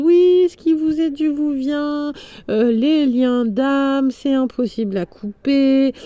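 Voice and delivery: high, slow preachy mock; mocking other psychics. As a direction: parody the soulmate psychics.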